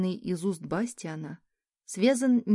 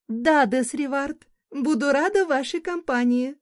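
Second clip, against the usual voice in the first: loud proclamation; 7 semitones higher, 6 dB louder than usual. A narrator's reading. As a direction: announce it grandly to the room. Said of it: "громко возвестила".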